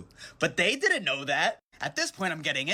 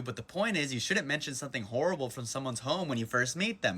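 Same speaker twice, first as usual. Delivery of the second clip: ordinary talk, nothing marked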